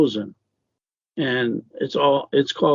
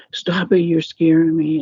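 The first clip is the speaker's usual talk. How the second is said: retold frightened plea, higher voice; scared sister's protest; 6 semitones higher than usual. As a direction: imitate a frightened little sister.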